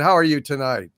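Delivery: ordinary talk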